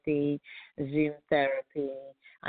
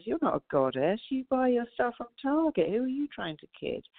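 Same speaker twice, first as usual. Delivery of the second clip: higher, mocking put-down by the inner critic; the mind mocking the goddess mantra, high and scornful; scoffing inner voice; 6 semitones higher than usual. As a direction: scoff at yourself like a sneering critic. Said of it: "And my mind goes".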